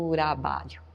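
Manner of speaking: trabalho softened to "rabalo", dropping the t, quiet; Japanese-style trabalho. Shown as a demonstration. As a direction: say trabalho as "rabalo", simple syllables, very soft. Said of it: "sílabas simples".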